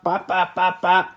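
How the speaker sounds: high, bouncy bop-bop engine sound; mouthed engine noise. Retold or imitated. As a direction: vocalize a revving V8 playfully.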